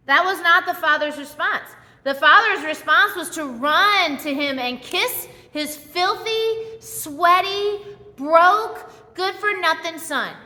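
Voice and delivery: ordinary talk, nothing marked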